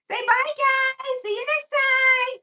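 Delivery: very high, slow, loud squeaky pet voice; voicing the dog. Owner making her dog say goodbye to viewers, very high squeaky. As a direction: wave goodbye as the tiny dog. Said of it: "say bye to the people".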